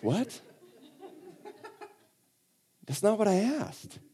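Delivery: soft, high-pitched mock confusion; hushed, puzzled little protest going up high; comic bafflement. Questioner given an odd answer by Jesus, comically baffled.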